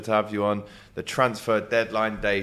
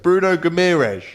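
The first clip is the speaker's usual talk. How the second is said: emphatic name; 6 semitones higher, 6 dB louder than usual. Acted. Repeating name for deaf guest.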